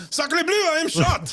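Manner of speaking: put-on hammy high French accent, shouting; over-the-top French cry of "Sacre bleu"; french chef. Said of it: "He would say things like".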